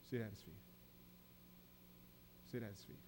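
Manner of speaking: a soft repeated call to stillness; hushed emphasis. Real, not acted. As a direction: drop the voice to a near hush to land it. Preacher lowers voice for emphasis.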